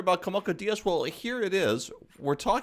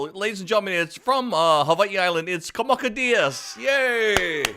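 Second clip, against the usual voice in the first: louder, higher hype-man introduction; announcer intro; 4 semitones higher, 6 dB louder than usual. Acted. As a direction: bring the guest on like a game show host. Host announces his guest grandly.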